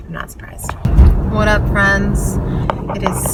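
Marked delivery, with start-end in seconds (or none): 0.1-0.7 unvoiced dry whisper. Real, not acted. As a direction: breathe it out deadpan. Deadpan aside after laughing.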